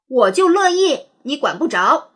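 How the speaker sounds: loud, high defiant retort, then settling; defiant glare. Acted. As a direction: snap back like a stubborn kid. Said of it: "瞪着眼睛说". (瞪着眼睛的倔强顶嘴)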